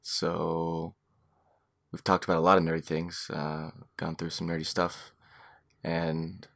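low, hushed, breathless voice; gasping out last words; running out of air. Pretend. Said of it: "I'm running out of oxygen".

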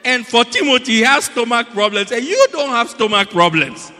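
loud, high-pitched preacher's rebuke; full-force preaching. Real, not acted. Preacher scolding drinkers over Timothy's little wine, full-force preaching. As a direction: belt it out to the congregation, drawing out the punchline.

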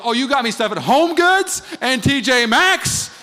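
high, slow, loud mocking drawl; husband sneering about the stores; sarcastic jab. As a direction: lay on the sarcasm. His sarcastic accusation.

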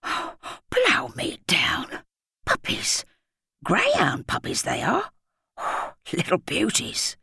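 high, slow, breathy awe over the puppies; awed splutter. A narrator's reading. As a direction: gasp it out in wonder. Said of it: "Then he was spluttering again".

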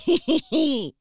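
big loud laugh; loud burst of laughter. Real, not acted. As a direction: laugh out loud before the point. Laughs loudly before preaching.

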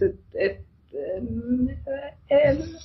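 ordinary talk

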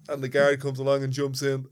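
usual speaking voice

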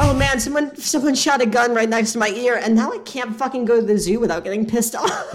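usual speaking voice

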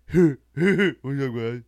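exaggerated impression of a guy he knew; impersonated acquaintance. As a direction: imitate an acquaintance's odd way of talking. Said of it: "He'd be like".

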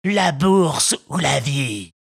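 slow, cavernous bandit demand. Acted. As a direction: growl it slowly like a robber. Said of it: "Deux voix caverneuses vociférèrent".

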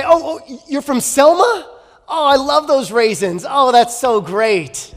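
high, loud hammed-up enthusiasm; gushing schmoozer. Prince flattering a visitor from Selma, gushing schmooze. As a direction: flatter them with drawn-out mock delight. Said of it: "And then he would say".

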